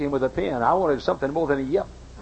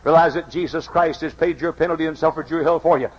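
ordinary talk

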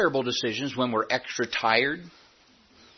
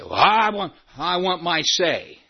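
a higher, petulant church member insisting on his due; hammed-up entitled grumble; mock whiny demand; 6 semitones higher than usual. Pastor mocking a congregant who wants his say, petulant. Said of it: "You say".

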